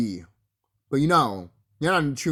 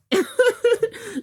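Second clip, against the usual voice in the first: high laugh; 4 dB louder than usual. Real, not acted. Vlogger cracking up at the mating cobras, laughing high.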